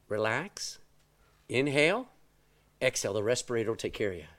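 soft, low self-calming recital; calming instructions. Retold or imitated. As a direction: talk yourself down gently. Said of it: "all of the instructions come back to you".

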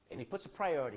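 normal talking voice

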